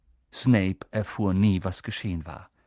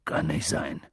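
low, shaken disbelief; deep voice, a touch of dread; trembling voice; 9 semitones lower than usual. A narrator's reading. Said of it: "meinte Hagrid mit zitternder Stimme".

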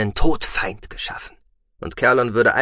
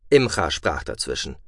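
ordinary delivery, nothing marked

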